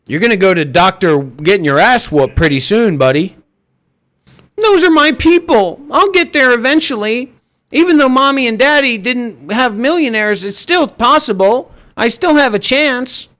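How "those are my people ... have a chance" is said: high, mocking whiny-kid voice; put-on whine, pitched way up; whining wannabe. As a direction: imitate a spoiled dreamer clinging to hope. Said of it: "Stop whining".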